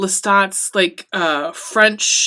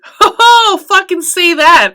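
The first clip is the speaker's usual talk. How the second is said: much higher and louder cackling cheer; delighted laughing shout; 10 semitones higher, 9 dB louder than usual. Real, not acted. She cheers the cruel line to her viewers, laughing loudly. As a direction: laugh it out, thrilled.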